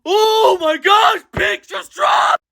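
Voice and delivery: high, loud, slow hyped yelling; excited shout. Real, not acted. Fan announcing the new comic, hyped loud shouting. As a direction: shout it like your hero is finally back.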